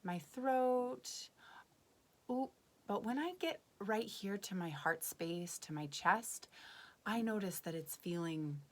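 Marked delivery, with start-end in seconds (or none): none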